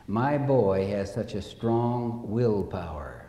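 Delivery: low, slow, soft mother's excuse; understated embarrassment, dropped low; embarrassed mother. Retold or imitated. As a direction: lightly voice an abashed mother, hushed and slow. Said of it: "And Mother blushes".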